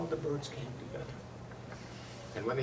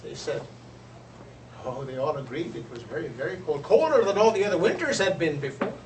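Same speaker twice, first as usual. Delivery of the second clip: usual speaking voice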